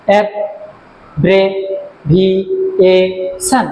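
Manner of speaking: অ্যাব্রেভিয়েশন slowly split into five syllables. Shown as a demonstration. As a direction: say অ্যাব্রেভিয়েশন with a short gap after each syllable. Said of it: "পাঁচটা syllable আছে".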